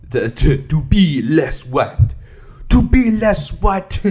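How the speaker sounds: slow, loud put-on reading voice. Mocking the author's tips, in a put-on voice. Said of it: "I'm just doing a voice".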